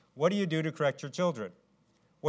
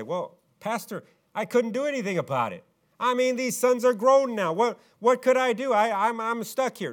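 whiny excuse; 7 semitones higher, 4 dB louder than usual. Retold or imitated. Eli's excuse voiced.

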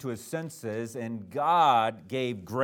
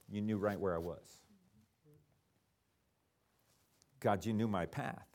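much softer, lower, intimate words to God; hushed prayer; 7 semitones lower, 10 dB quieter than usual. Acted. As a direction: drop to a hush as if praying alone. David's quiet prayer voiced.

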